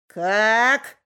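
loud offended pig grunt; offended pig, loud and sulky. Acted. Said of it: "сказала свинья и насупилась". (обиженная свинья, громко)

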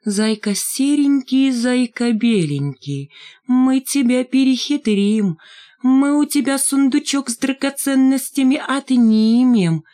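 high, slow teasing singsong; drawn-out mocking chant in a small high voice; thin singsong little voice. A narrator's reading. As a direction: sing it out in a thin fox voice. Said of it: "пропела тоненьким голоском".

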